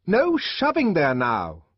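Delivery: high, loud angry order; furious shout. Acted. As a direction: bark at the crowd. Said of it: "shouted the deputy sub-bursar in a fury".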